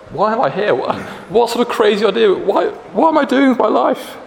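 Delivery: very high-pitched exasperated wail; mock despair. Exhausted runner questioning themselves at race's end, high-pitched mock despair. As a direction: act out a runner's rising panic.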